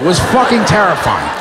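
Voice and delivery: ordinary delivery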